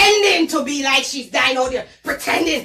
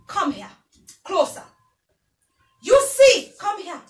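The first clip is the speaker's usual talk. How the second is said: ordinary talk, nothing marked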